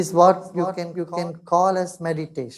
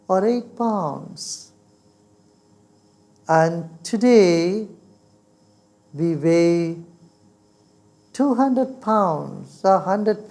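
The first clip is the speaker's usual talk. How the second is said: guided meditation; 3 semitones lower than usual. Real, not acted.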